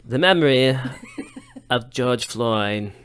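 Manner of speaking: a hint of a spacey hippie; drawn-out dreamy hippie delivery. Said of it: "You sound like a hippie".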